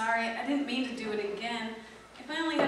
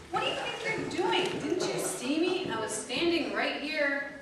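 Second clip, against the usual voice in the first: ordinary talk, nothing marked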